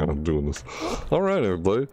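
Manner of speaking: usual speaking voice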